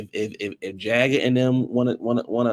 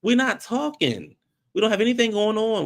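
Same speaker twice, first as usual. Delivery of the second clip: exasperated; 9 semitones higher than usual. Real, not acted. Exasperated repeat.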